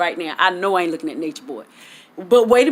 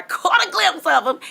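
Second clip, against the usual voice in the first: much higher, dreamy swoon on caught; swooning repeat; 11 semitones higher than usual. Real, not acted. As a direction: relive the thrill, swooning. Repeats line, second swooning.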